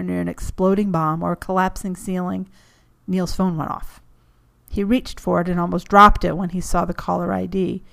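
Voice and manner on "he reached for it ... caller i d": usual speaking voice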